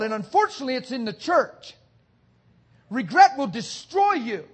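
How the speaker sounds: ordinary talk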